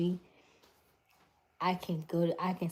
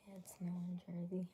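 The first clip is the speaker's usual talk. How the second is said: hushed whisper; 11 dB quieter than usual. Real, not acted. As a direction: read it under your breath. Whispered chat reading.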